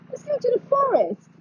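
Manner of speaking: lilting high call to the moon; high singsong call. Pretend.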